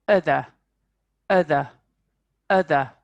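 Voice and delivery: "other" for "are the", stressed; reduced are the. Shown as a demonstration. Teacher showing "are the" as "other".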